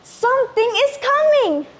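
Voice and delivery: frightened villagers. Acted. Villagers scream a warning of the sea monster, panicked. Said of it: "The villagers screamed".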